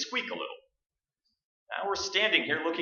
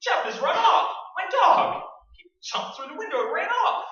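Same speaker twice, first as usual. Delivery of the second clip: agitated; 10 semitones higher, 4 dB louder than usual. Acted. Reporting dog ran.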